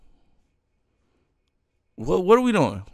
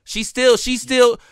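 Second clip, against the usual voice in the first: ordinary delivery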